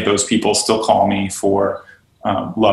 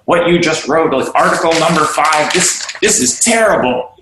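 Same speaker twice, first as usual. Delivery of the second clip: higher, mock-annoyed complaint; lightly voiced griping coworker; complaining boss; 6 semitones higher than usual. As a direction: whine it like a fed-up boss. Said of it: "they're like".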